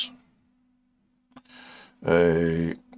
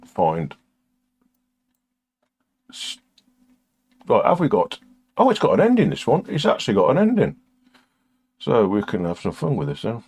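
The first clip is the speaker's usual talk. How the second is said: normal talking voice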